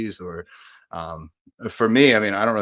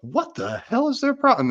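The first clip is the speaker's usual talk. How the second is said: mock indignation, voice jumps high; outraged bystander; indignant shock. Bystander yelled at by a stranger, mock indignant. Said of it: "you're like".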